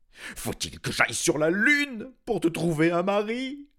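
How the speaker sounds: high, loud desperate king shout; a frantic king crying out to the sky; king yelling. A narrator's reading. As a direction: yell it in despair. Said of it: "hurla-t-il".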